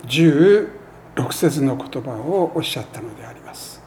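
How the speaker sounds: ordinary talk, nothing marked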